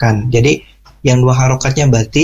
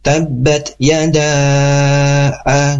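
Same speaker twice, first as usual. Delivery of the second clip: "yada" drawn out, then the A set on the next beat; YADA held five beats; much slower than usual. Shown as a demonstration. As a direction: slowly stretch "yada", then voice a separate A. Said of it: "A-nya masukkan ke ketukan kelima". ("yada" panjang lalu A di ketukan kelima)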